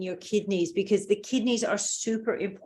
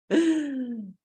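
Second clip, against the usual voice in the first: short laugh; 6 semitones higher than usual. Real, not acted. Laughs at the class answers.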